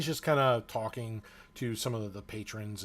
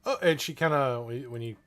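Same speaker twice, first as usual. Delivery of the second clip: normal talking voice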